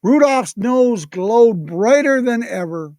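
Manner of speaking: usual speaking voice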